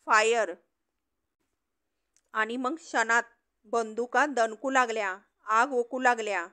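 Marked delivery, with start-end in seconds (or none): 0.0-0.5 restrained narrated shout, a little louder; commanding shout. A narrator's reading. Police chief orders his men to fire, mild shout. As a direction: bark the order, held back in narration. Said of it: "तसा फौजदार ओरडला".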